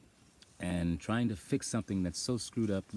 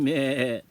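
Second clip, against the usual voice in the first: high, loud goat bleat; 8 semitones higher, 12 dB louder than usual. Retold or imitated. Calling to the goat, bleating. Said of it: "I did it again".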